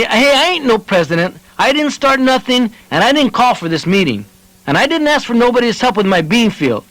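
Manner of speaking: high, indignant angry refusal; angry protest. A narrator's reading. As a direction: refuse hotly, offended. Joe protests angrily.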